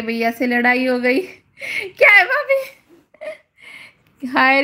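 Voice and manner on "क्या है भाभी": mock crying. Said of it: "क्यों रो रही हो".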